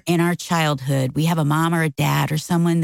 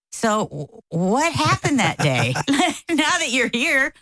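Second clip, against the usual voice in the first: ordinary delivery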